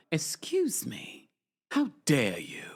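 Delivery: drawn-out mock outrage in English accent; put-on English accent. Rebuking a teasing viewer, in a mock-outraged English accent. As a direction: play the scandalized pretend Brit. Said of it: "Not you sounding like Dorito".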